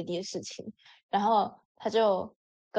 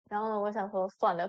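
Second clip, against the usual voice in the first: ordinary delivery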